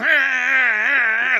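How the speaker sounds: high, loud rising engine whine; imitated engine revving. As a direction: mimic an engine being gunned. Imitating truck hammered down again.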